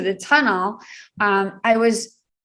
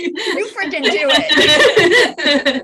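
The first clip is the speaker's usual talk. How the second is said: slow, laughing, high emphatic punchline; 6 semitones higher, 3 dB louder, much slower than usual. Real, not acted. Laughing as she tells the hosts a thirteen-year-old obeys her mother. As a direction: drag it out through a laugh.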